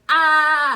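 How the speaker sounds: loud, high, open "ah" scream; reenacted scream. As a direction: cry out loud and high, dramatized. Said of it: "It felt like".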